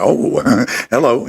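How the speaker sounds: slow, charmed "oh, hello" with a laugh; host imitating himself swooning at an accent, mock flirty. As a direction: act smitten by the accent, stretch it out. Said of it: "always it's like".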